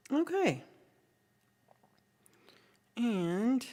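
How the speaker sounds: ordinary delivery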